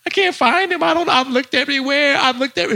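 high, tearful whine, acted; voice cracking. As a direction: play a grown man starting to cry. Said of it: "My voice started to crack".

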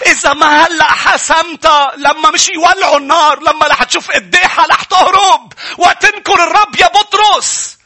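high, loud, slow shouted warning; urgent pulpit shout with stretched words; full-force preaching. Real, not acted. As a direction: hammer the challenge out at full voice. Preacher challenges congregation at full force.

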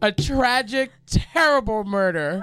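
high, drawn-out mock tragedy; imitated courtroom melodrama, pitched high; dramatic student lawyer. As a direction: announce it like a hammed-up prosecutor. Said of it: "being as dramatic".

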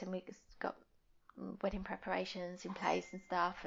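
ordinary delivery

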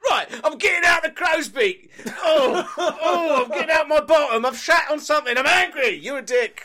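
high, loud hammed-up rant voice; mock rant. Eli hamming up a rant for the audience. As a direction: rant like a sulky cartoon character. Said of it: "did a rant".

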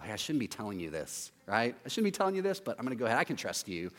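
hushed, low gossip voice; mock gossiping. Gossiping fool leaking a secret, hushed and low. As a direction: lean in and spill a secret you shouldn't. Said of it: "the people who share secrets".

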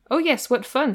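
a touch of gushing enthusiasm; lightly voiced eager gentleman; eager delight. A narrator's reading. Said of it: "said Mister Saltina".

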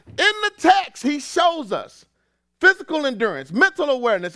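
high, loud pulpit voice; rally the church; fired-up preacher's call; preaching. Acted. Preaching rises again.

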